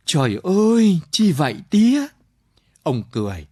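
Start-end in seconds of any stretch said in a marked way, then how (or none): 0.0-2.1 lightly voiced young son, higher; a son crying out to his father, a touch higher; son's exclamation. A narrator's reading. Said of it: "Thằng Thàn kêu".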